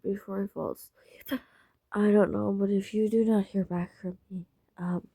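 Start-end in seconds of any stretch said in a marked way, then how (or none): none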